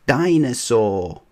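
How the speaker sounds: put-on slow, wailing toddler cry of dinosaur. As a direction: wail for the lost toy. George crying for lost toy.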